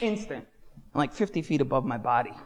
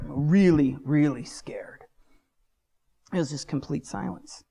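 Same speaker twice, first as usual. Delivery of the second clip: usual speaking voice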